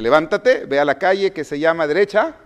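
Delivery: loud, high, forceful command; the Lord commanding. Acted. Preacher reads the Lord's orders to Ananías, commanding loudly. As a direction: proclaim the order at full volume. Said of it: "El Señor le dice".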